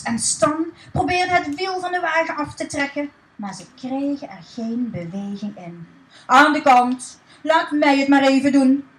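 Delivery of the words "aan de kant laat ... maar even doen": loud bossy order; barked order to step aside; commanding. Acted. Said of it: "beval Dick".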